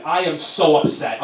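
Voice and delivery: ordinary delivery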